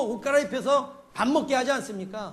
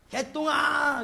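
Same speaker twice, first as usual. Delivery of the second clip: put-on higher, drawn-out call to a child; raised voice, stretching the last syllable; 4 semitones higher, 4 dB louder, much slower than usual. Mother hollering her child in for dinner, calling out. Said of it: "그리고 부르죠".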